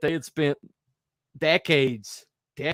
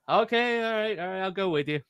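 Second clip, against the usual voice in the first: high-pitched mock compliance; acted giving in; 6 semitones higher than usual. Boy Jesus to his parents, mock compliance. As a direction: give in, pitched well up.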